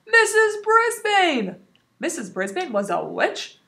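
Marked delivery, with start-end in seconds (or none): 0.1-1.6 high, slow loud shout; excited child's shout. Acted. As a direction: call out the name across the room. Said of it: "Small Paul shouted".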